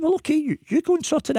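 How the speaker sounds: high, mock-breezy "off you go"; high teasing go-ahead; sarcastic concession. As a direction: lift it high, teasing him. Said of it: "I said".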